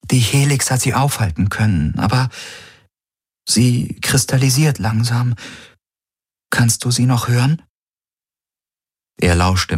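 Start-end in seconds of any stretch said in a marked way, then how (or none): 0.1-7.6 put-on raised higher, gentle young woman; Kirai's voice. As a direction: speak as Kirai, caring for the wounded.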